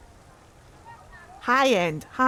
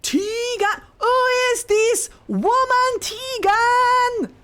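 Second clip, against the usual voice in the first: much higher, louder and slow hammed-up cry; mock jealous wail; 5 dB louder, much slower than usual. Teasing a game character over another woman, a hammed-up jealous wail. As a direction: wail like a betrayed lover, overacted.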